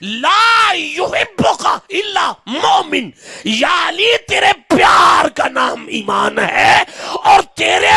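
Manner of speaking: high, drawn-out impassioned shouting; soaring, slow pulpit shout; full-force preaching. Real, not acted. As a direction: cry out each phrase to the congregation, stretching the endings.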